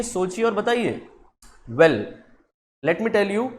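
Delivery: ordinary talk, nothing marked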